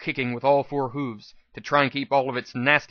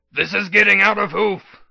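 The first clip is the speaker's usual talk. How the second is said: higher, louder call; 6 semitones higher, 6 dB louder than usual. A narrator's reading. P twenty-one calls down into the pit, loud and alarmed. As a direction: call down to the fight below. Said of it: "called from the lip".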